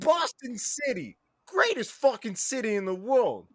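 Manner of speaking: high proud Boston boast; Boston bro. Acted. Boston bro boasting his city in a high proud voice. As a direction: brag it up high like a hometown fan.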